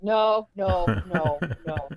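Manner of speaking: loud first no, then backing off; mock pleading protest at being dragged along; acted-out reluctant refusal; reenacted panicked refusal. Daughter resists being dragged over to Frank.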